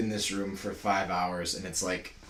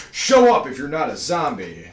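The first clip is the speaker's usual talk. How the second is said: higher, louder announcer call; 8 semitones higher, 12 dB louder than usual. Acted. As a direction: shout it like an ad. Mock ad announcement continued.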